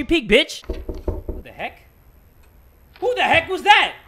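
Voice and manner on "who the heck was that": high, loud alarmed yelp; jumpy, raised outcry; startled shout. Real, not acted. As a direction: demand it, jolted by a sudden knock. Startled by a knock.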